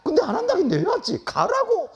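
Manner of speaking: high, drawn-out mock order to go; exasperated dismissal. Acted exasperated order to leave. (짜증 섞어 높게 과장한 목소리)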